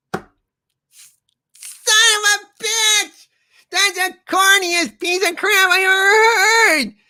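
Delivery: high, shouting cartoon rage voice; outraged old crank. Streamer voicing a character furious at the corny joke, high shout. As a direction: blow up at the pun in a high screechy voice.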